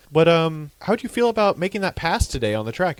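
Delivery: high, bright-eyed reporter question; mock eager interviewer. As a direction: ask it like a keen trackside reporter.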